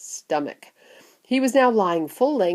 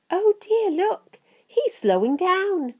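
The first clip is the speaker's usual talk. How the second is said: put-on high, slowing child voice; high-pitched worried observation; worried Charlie; 12 semitones higher, slower than usual. A narrator's reading. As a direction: say it as an anxious young boy. Charlie watches the boy anxiously.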